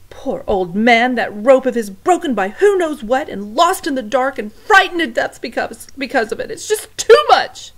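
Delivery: loud, high wailing lament; rising to a loud, high wail; distraught cook. Acted. Cook mourning the old man, loud and high.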